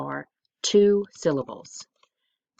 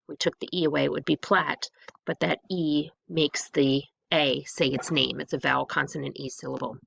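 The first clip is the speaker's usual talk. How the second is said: ordinary talk, nothing marked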